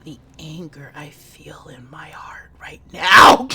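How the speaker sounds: a slow furious whisper through a sob; seething whisper. Acted. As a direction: hiss the anger under your breath, slow. Gamer whispering fury at game.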